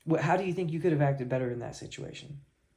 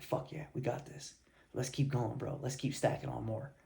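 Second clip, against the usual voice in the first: hushed hype; 2 semitones lower, 8 dB quieter than usual. Retold or imitated. Riding together, pumped teammates.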